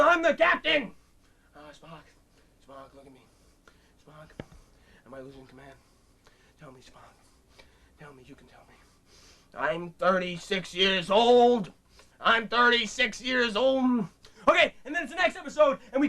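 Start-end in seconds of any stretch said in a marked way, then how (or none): 1.6-8.7 put-on much lower near-whisper old man; softer, lower aged Kirk pleading; frail old Kirk. 9.6-14.1 low, slow aged voice; slow low lament of an aged captain; old Kirk despair. Acted.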